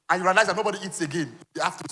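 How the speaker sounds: ordinary delivery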